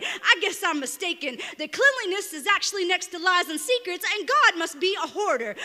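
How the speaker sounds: building to loud, high-pitched, driving fury; angry poem rant. Acted. Poet rousing the dead at Oakwood Cemetery, building angry rant. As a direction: spit out the verse in rising outrage.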